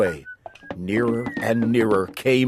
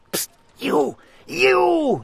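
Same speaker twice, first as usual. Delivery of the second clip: hoarse nervous whisper; slower than usual. A narrator's reading. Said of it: "hissed in a hoarse, nervous whisper".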